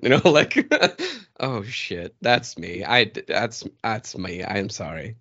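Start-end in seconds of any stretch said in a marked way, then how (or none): 1.4-5.2 low, soft mock confession; sheepish admission, acted. Retelling a bullying chat, owning up sheepishly and low. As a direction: own up quietly, pitched down. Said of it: "bullying chat to be like".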